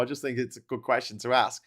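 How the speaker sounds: ordinary talk, nothing marked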